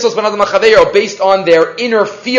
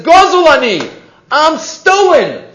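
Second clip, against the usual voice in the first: a mild cry of protest from the lulav, higher and slow at the end; 7 semitones higher, slower than usual. Retold or imitated. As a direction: plead lightly, as the stolen lulav. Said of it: "The lulav itself cries out".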